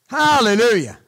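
ordinary delivery

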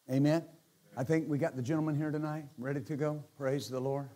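ordinary talk, nothing marked

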